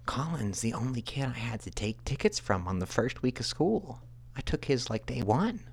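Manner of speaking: high-pitched mock teacher voice; math teacher. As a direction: imitate a teacher reporting to a parent. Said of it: "she was like".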